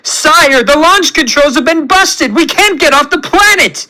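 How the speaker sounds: high, frantic alarm; shrieked underling panic; Theo's panicked scream. A narrator's reading. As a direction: scream the bad news to your master. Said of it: "as Theo screams".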